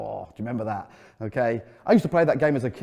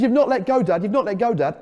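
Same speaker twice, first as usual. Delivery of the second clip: put-on very high, louder boy's call; excited child call; 8 semitones higher, 5 dB louder than usual. Son riding alone calls back to dad, high excited voice. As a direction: shout it like a thrilled small kid.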